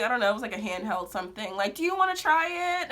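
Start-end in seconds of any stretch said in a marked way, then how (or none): 1.8-2.9 high-pitched mock sales offer; saleswoman impression. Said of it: "Doing their sales pitch".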